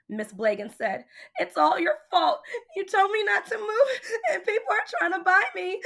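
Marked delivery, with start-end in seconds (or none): 1.4-5.8 high, crying complaint. A narrator's reading. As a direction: wail it out, blaming daddy. Crying girl blames her father.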